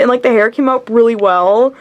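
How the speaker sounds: usual speaking voice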